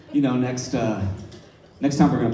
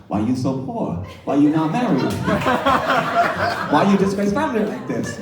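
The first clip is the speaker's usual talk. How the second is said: higher, scolding ghost grandma imitation; nagging grandmother voice; 6 semitones higher, 3 dB louder than usual. Ghost grandmother nagging him, imitated in a scolding voice. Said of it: "all I've been hearing over and over is".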